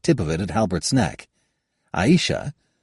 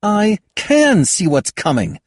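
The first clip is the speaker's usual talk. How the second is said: slow, firm and higher; 6 dB louder, slower than usual. Acted. Kayada answers Halbert firmly, slow and certain. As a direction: state it with steady certainty. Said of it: "Kayada answered him firmly".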